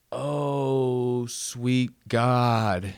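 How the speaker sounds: slow, pained lament; pained exclamation. Real, not acted. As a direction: stretch it out like an exposed nerve was hit. Agony over the exposed nerve.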